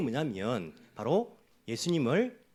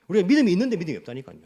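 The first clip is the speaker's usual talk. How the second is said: louder and fast with a laugh, 믿음이 stressed; laughing insistence; 7 dB louder, much faster than usual. Shown as a demonstration. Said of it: "무슨 말이, 무슨 말이죠?".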